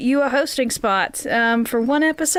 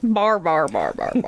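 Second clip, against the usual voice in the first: playful low drone; 6 semitones lower than usual. Acted. Playful repeating, voice dropping.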